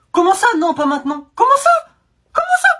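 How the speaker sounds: loud, shooting up very high; outraged disbelief. Acted. Comic refused permission to pee, mounting high-pitched outrage. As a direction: escalate to a high squawk of disbelief.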